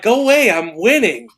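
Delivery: loud, high shooing away; re-enacted irritated outburst; annoyed brush-off. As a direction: shoo someone off mid-game, loudly. Brushes off interrupting camera guy.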